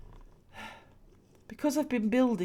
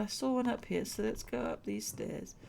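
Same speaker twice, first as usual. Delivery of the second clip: ordinary talk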